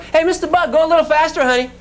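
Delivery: high, playful call to a bug; high-pitched playful coaxing. Acted. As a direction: urge a tiny swimmer on in a high voice. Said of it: "Now you say to the bug".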